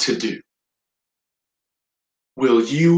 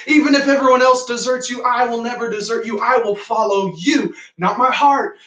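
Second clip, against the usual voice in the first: higher, rushed vow in Peter's voice; fast shouted outburst; 9 semitones higher, 3 dB louder than usual. Acted. Peter vowing to Jesus he will never desert him, shouting out fast. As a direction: blurt it out loudly and fast. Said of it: "Peter again just shouts out real fast".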